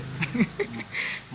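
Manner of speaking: soft, low chuckle; small real laugh under the breath; amused chuckle. Real, not acted. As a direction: chuckle quietly at your own joke. Jokes about forgetful mothers' turns.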